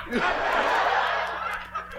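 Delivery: soft wheezing laugh; a quiet airy laugh at his own tale; wheezy laugh; breathy laugh. Real, not acted.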